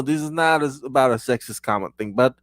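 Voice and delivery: normal talking voice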